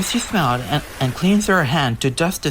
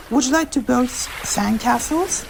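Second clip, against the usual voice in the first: eager younger sister; 11 semitones higher than usual. Acted. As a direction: invite your sister to play. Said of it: "asks Arcee to Elita One".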